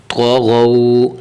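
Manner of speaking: تو غو with thick "to" and "ghow"; slow, rounded heavy consonants in تو غو; heavy, full letters. Shown as a demonstration. Teacher showing thick letters, heavy drawn-out تو غو. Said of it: "حرف غین کو بھی موٹا کرنا ہے".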